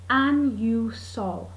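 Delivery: slow ANUSOL, each syllable stretched; weighty three syllables. Retold or imitated. Working out the medicine name, slow and weighty. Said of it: "as if three syllables makes it more weighty".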